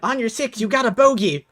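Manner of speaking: high-pitched lookout's warning call; Dewdrop. Acted. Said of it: "you hear Dewdrop".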